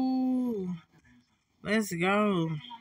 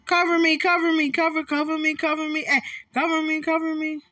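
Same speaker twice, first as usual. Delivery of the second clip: urgent raised calls to teammates; cover me shout; 5 semitones higher, faster than usual. Real, not acted. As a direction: call for cover, pressing. Urgent call to teammates while reloading.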